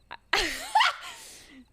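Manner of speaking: high, loud laugh; burst of laughter. Real, not acted. Player laughs before trying the accent, high burst of laughter.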